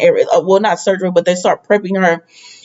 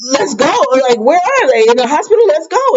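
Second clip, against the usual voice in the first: higher-pitched, retold urgent questioning; urgent demand; 7 semitones higher than usual. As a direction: act out an anxious push to leave, pitch climbing. Retold mother reacting to shooting news.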